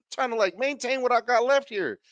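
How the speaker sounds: usual speaking voice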